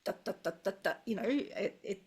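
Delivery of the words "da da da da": even "da, da, da, da" like a machine gun; even syllables in a rapid-fire stream; machine-gun rhythm. Shown as a demonstration. Teacher imitating Japanese rhythm, machine-gun syllables. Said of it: "It sounds like a machine gun".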